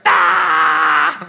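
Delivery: a huge, high yelp of a laugh breaking out; burst of laughter shout. Retold or imitated. Son can't hold it in after his dad falls, loud yelp. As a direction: let the laugh explode out loud. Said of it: "then he's like".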